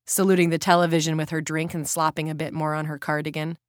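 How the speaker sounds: plain reading voice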